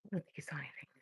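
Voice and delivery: hushed, hoping nobody noticed; quiet whisper to the camera. Real, not acted. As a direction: whisper it conspiratorially. Whispered reassurance to viewers.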